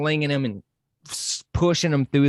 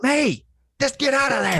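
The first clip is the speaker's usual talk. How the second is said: high, urgent shouted warning; frantic yell; 13 semitones higher than usual. Retold or imitated. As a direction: yell at her to run. Yelling at screen, Aunt May.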